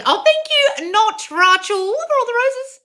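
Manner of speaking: very high, sing-song sarcastic thank-you; high mock-sweet gratitude; mock gushing thanks. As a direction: gush it out in a squeaky put-on voice. Mock gushing reply to comment.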